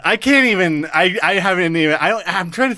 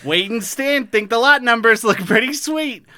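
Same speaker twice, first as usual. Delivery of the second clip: put-on light Minnesota accent summary read. Podcaster reading the Fargo recap in a light Minnesota accent. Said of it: "as I'm reading in this accent".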